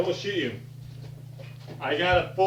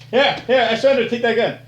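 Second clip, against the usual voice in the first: surrender shout; 6 semitones higher, 7 dB louder than usual. Pretend. Mock surrender in toy gun fight.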